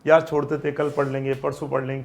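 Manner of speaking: low, soft voice of putting it off; procrastinating student. Acted. Student telling themselves to study tomorrow, low and soft procrastinating. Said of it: "अपन ने अगर सोच लिया".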